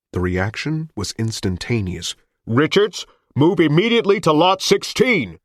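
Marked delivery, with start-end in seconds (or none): 2.5-5.4 loud amplified command; booming loudspeaker order; megaphone voice. Acted.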